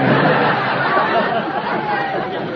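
a short chuckle at his own joke; laughing. Real, not acted. Quiz host chuckling at the salesman's commute, real laugh. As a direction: laugh at your own wisecrack.

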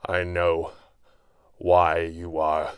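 slow, broken phrases with long gaps; halting words. A narrator's reading. Wounded hero struggles to speak to his enemy, halting. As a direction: force out each word through pain. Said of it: "a few halting words escaped his lips".

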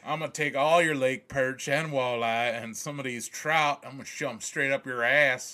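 a hint of a Southern tough guy; mock Southern accent. Yooper threatening the invaders, mock Southern accent. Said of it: "we're speaking in southern accents".

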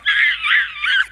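put-on girls' terrified shriek. Youth group girls ambushed in the dark: terrified scream. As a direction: shriek like a startled teenage girl.

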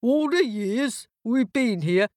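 talking doors. A narrator's reading. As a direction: complain as an old neglected door. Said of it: "said the doors".

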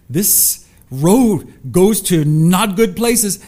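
put-on slow warning like an alarm in the child's head; amygdala voice. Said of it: "his amygdala says".

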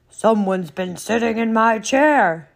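loud, big bear bellow; huge storybook bear voice at full volume; great huge Papa Bear. Acted. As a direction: boom it out as an indignant Papa Bear. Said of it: "in his great, huge voice".

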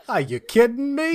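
loud, high, hammed-up New Yorker; New York accent. Fan imitating the audiobook narrator, loud New York accent. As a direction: exclaim it like a New York hot dog vendor. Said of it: "was like a New".

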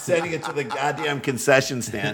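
ordinary delivery

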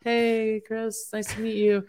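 mock the guests' cheerful hello; mimicking guests greeting him mid-meltdown; imitating guests. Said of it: "people here in the next room that were like".

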